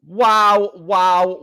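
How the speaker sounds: normal talking voice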